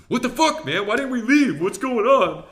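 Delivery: high-pitched, loud mock outrage; imitated raging. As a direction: act out a teammate freaking out in protest. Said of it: "raging a little bit".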